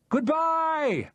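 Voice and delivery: long shouted goodbye; crowd shout. Acted. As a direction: call out as the boat pulls away. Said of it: "a score of voices shouted".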